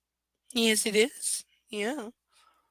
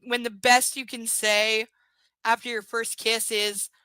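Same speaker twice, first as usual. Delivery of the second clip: louder, slow disbelieving emphasis; mock the line in disbelief; incredulous; 3 semitones higher, 5 dB louder, slower than usual. Real, not acted. Mocking the book's line in loud, slow disbelief.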